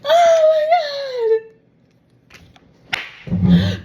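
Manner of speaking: very high, loud half-laughing, half-crying wail; overwhelmed cry. Real, not acted. Reader overcome by the collective's reading. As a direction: overcome with joy and emotion.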